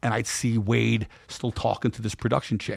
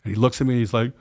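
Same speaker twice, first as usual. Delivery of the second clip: ordinary delivery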